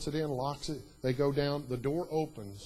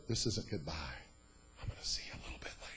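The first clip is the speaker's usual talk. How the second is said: low tender whisper; 9 semitones lower, 8 dB quieter, faster than usual. Retold or imitated. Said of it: "You know what he said?".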